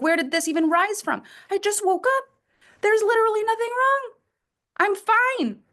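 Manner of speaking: high-pitched, defensive exasperation; exasperated protest. Acted. Coach acting out a mood from nowhere, exasperated. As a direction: insist nothing is wrong, pitched right up. Acts out baseless sudden irritation.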